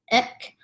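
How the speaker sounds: "ek" cut short; brief "ek". Shown as a demonstration. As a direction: say "ek" briefly, then stop. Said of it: "short ek".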